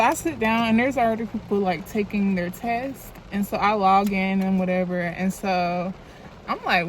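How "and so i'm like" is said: ordinary delivery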